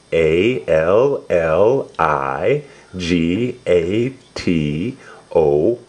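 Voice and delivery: slow letter-by-letter spelling; spelled letter by letter. Shown as a demonstration. Parent spelling alligator for a listening child, slow deliberate letters. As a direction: spell out each letter slowly and clearly.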